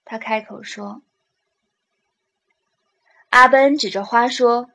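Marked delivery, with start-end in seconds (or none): none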